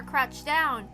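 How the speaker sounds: usual speaking voice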